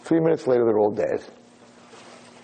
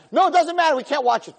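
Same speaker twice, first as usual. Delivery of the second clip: high-pitched urgent objection; imitated frantic protest; 4 dB louder than usual. Students refusing to watch the fish suffer, high frantic protest. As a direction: cut in, high and insistent. Class still protesting to rabbi.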